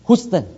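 "Houston" said HOOS-ton, with no y sound; mispronounced Houston. Shown as a demonstration. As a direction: say "Houston" with an oo after a plain H. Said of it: "I call like this".

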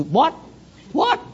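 sharply rising "What?"; puzzled listener. Acted. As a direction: ask it like you didn't catch the word. Acts puzzled listener straining to hear.

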